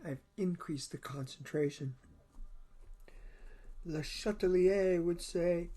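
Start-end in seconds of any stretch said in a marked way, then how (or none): 3.8-5.7 bad French accent. Chemistry teacher voicing Le Chatelier in a bad French accent. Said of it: "my bad accent".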